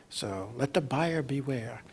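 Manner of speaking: ordinary talk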